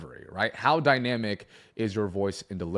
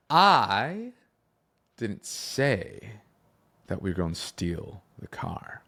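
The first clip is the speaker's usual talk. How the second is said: varied cadence, slow; much slower than usual. Shown as a demonstration. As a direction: draw it out for suspense, piece by piece. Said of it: "speed at which you deliver your lines".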